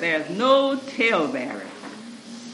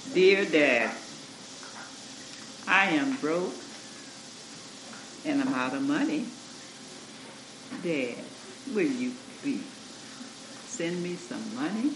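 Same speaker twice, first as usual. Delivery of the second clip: soft, polite pleading reading, quieter; a much softer, humble reading of the letter; gentle tone; 6 semitones lower, 8 dB quieter than usual. Retold or imitated. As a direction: read it kindly, asking nicely. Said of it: "same words, but in a different tone of voice".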